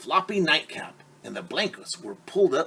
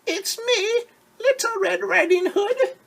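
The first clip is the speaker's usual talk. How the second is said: put-on wolf faking a little girl; wolf's little girl voice; 6 dB louder than usual. Wolf at grandmother's door pretending to be Red, falsetto. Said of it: "in his best little girl voice".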